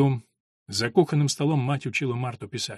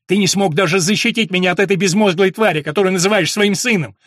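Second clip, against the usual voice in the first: loud, high, furious accusation; raging, voice raised high; angry outburst; 6 semitones higher, 10 dB louder than usual. A narrator's reading. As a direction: hurl the reproach at full voice. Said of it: "взорвался Джек".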